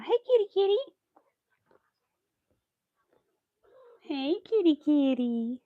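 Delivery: high cooing kitty call; pet-owner's high-pitched call; calling the cat. Real, not acted.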